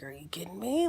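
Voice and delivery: soft unvoiced whisper of disbelief. Learning the husband did this before, whispered disbelief. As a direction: breathe it in shock.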